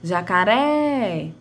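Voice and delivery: "jacaré" with the last syllable "ré" drawn out long; slow "jacaré", long stressed "ré"; stretched final syllable. Shown as a demonstration. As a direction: call out "jacaré", holding the final "ré". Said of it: "a sílaba que mais se estende é a ré".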